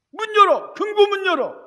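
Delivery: high shouted demand; robber's demand. Retold or imitated. Robber yelling at the brothers to open the safe. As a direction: scream it at the shopkeepers.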